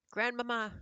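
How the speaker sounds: high, loud call through the door; a boy shouting for his grandmother; shouting child. A narrator's reading. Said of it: "I shouted as loudly as I possibly could".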